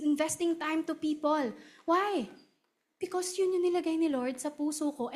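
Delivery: ordinary talk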